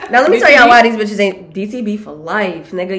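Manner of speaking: ordinary talk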